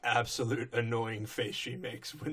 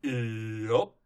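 low, laconic farmhand yup; Big Mac voice; 4 semitones lower than usual. Retold or imitated. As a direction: drop it low and deadpan.

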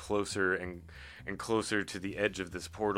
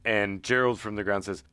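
normal talking voice

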